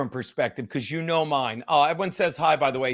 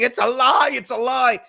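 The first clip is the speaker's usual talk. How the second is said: high, raised-voice imitation of own screaming; mock yell, pitched up; retold screaming; 6 semitones higher, 5 dB louder than usual. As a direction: re-enact your own outraged screaming. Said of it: "me screaming".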